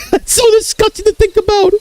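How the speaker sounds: very high, squeaky laughing speech; helpless high-pitched laughter; real laughing. Real, not acted. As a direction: squeeze the words out through laughter. Cracking up over his own joke.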